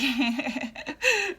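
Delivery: amused laugh at one's own wish. Real, not acted. Overwhelmed mover wishing for hired help, laughing. Jokes about hiring somebody for moving.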